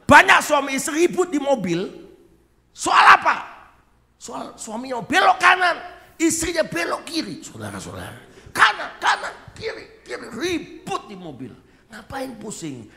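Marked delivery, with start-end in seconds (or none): 0.1-7.4 very loud, high-pitched exclamation; exasperated shouting. Real, not acted. Preacher on couples fighting in the car, shouting high. 8.5-11.5 high, frantic back-and-forth squabble; mock bickering. Husband and wife argue over turning, mock squabbling.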